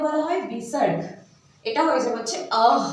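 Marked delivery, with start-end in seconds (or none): 2.7-2.8 "অহ" as uh ending in an h; visarga sound. Shown as a demonstration. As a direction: let "অহ" finish on a puff of h. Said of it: "একটা হ এর মতো উচ্চারণ". ("অহ" শেষে হ-এর মতো শ্বাস দিয়ে)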